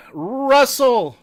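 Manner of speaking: loud, high name call. Real, not acted. Streamer calling out to a viewer in chat, calling out. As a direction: holler the name across the room.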